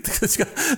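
high laugh at himself; burst of laughter. Real, not acted. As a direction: break into a high giggle. Laughing at own swearing. (ξέσπασμα σε ψηλό γέλιο)

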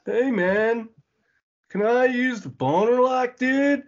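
high, dopey stoned dude; stoner voice. Retold or imitated. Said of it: "He sounds like he's stoned".

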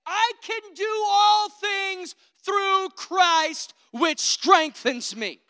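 slow, loud and high proclamation of faith; faith voice shout. Pretend. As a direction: declare it boldly, stretching each word. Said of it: "The faith voice will say".

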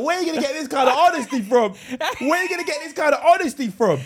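laughing disbelief. Real, not acted. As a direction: marvel through laughter.

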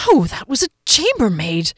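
panicked denial. A narrator's reading.

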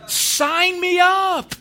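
high, drawn-out, loud cheer; excited shout. Retold or imitated. Customer jumping at the new plan, high excited shout. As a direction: holler it with eager enthusiasm, stretching the words. Retold eager acceptance of offer.

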